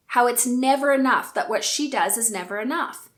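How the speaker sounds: normal talking voice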